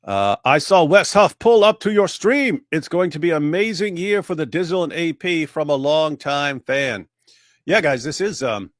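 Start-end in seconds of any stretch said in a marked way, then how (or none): 0.5-7.1 read out loud. Acted. Reading a viewer's donation message.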